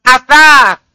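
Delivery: "Asas." with the tongue tip at the upper teeth, said as athar; Arabic th sound. Shown as a demonstration. Teacher shows the student the th in "Asas.". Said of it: "Ini asas".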